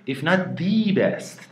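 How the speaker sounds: slow "if not THE best", THE as a high "thee"; emphatic THE. Shown as a demonstration. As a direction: stretch and raise THE in "the best". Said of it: "داره میگه اگه بهترینه".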